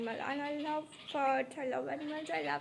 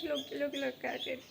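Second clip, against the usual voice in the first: usual speaking voice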